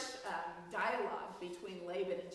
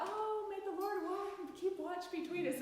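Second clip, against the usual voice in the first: mock sweet romantic; 11 semitones higher than usual. Shown as a demonstration. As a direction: coo it like a soppy love note. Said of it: "wasn't a sweet romantic gesture".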